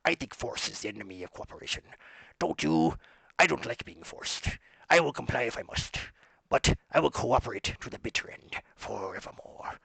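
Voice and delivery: low, measured stage oration; hammed-up solemn theatrical reading; theatrical character voice. Shown as a demonstration. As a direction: declaim it low and deliberate. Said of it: "Shakespearean thing".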